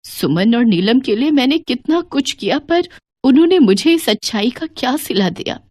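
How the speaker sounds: sobbing, wavering apology that builds into hurt; tearful breakdown. Acted. Mother-in-law begging her daughters-in-law's forgiveness, breaking into tears. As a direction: plead tearfully, breaking off into sobs. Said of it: "फूट फूट कर रो पड़ती हैं".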